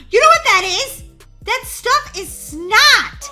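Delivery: loud, high outburst of disgusted discovery; grossed-out excitement. Real, not acted. Player telling partner what the slime is, grossed-out excitement. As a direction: blurt it out high and loud, stretching the end.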